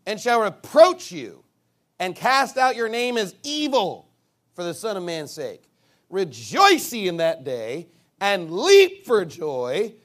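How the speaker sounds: loud, slow preacher's Bible reading; emphatic scripture reading. Acted. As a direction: punch the key words and stretch them out. Reads scripture aloud emphatically.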